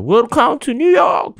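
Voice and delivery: very high, slow, drawn-out welcome; cheerful auntie. Retold or imitated. Auntie greeting arrivals at the airport, cheery high-pitched welcome. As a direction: mimic an exuberant airport greeter. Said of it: "陽気なおばさんがですね".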